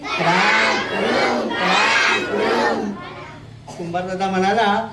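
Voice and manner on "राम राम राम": drawn-out very slow crow's ram ram ram; savouring the food. Acted. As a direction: stretch each राम like a crow relishing each bite. Crow savouring food in corner. (अतिशय सावकाश, चव घेत राम राम राम)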